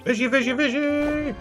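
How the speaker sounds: very high coaxing call; squeaky sing-song call. Pretend. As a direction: call the fish in a tiny high voice. Calling the fish again.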